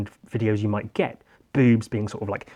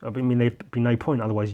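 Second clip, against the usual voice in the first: ordinary talk, nothing marked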